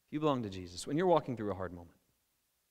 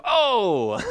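a sudden high "Oh!" of dawning; mock surprise; 7 semitones higher, 10 dB louder than usual. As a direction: act out the disciples catching on. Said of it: "The, the reaction of".

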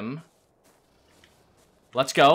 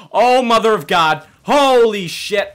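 loud, raised-pitch cry of alarm; alarmed outcry; 5 semitones higher, 9 dB louder than usual. Real, not acted. Player suddenly attacked in the game, loud alarm. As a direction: blurt it out in startled panic.

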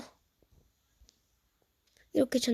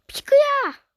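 high, loud call; 13 semitones higher, 9 dB louder, slower than usual. Real, not acted. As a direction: call out to someone. Calling to the pig. (큰 소리로 부르기)

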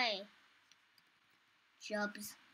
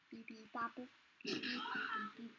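beeping noises; 4 semitones lower, 17 dB quieter than usual. Pretend. Imitating machine beeps.

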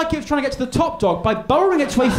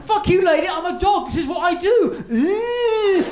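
lightly voiced defiant dog, higher; dog's defiant voice; 8 semitones higher than usual. Dog defying the posh lady, lightly voiced. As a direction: give the dog a cocky higher voice. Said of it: "Bernard's like".

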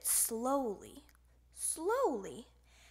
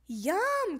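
delighted yum; 6 semitones higher, 3 dB louder than usual. A narrator's reading. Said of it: "cried Froggy".

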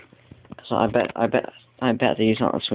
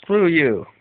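playful loud jab, pitched up; mock insult; 4 semitones higher, 5 dB louder than usual. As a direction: fire it at the dog in fun.

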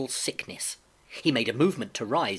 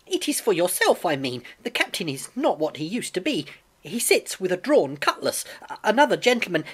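put-on raised, insistent young voice; urgent pleading boy; 6 semitones higher, 4 dB louder than usual. A narrator's reading. Boy warns blind man about the captain, urgently pleading. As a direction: plead upward, building louder until cut off.

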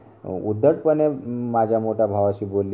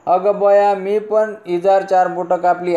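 put-on high, loud old mother's exclamation; mother's dismay; 10 semitones higher, 3 dB louder than usual. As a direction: cry out like a startled village mother. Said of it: "आई म्हणाली". (उंच, मोठ्या आवाजात आईचा आश्चर्याचा उद्गार)

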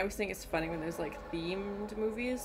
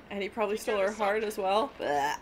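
ordinary delivery

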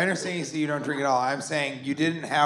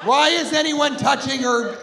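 outraged shout; 9 semitones higher than usual. Retold or imitated. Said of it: "I'm like".

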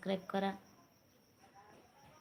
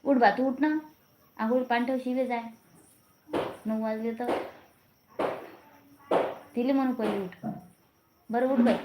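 loud, higher call to someone in another room; calling out loudly; 5 semitones higher, 5 dB louder than usual. Real, not acted. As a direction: shout across the house to wake her up. Calling family member to wake up. (घरातल्या माणसाला उठवण्यासाठी मोठ्याने हाक)

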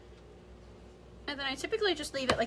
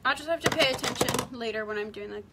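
ordinary talk

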